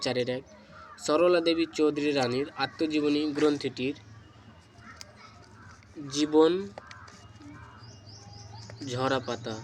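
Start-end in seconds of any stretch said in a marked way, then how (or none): none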